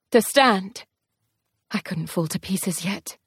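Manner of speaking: ordinary talk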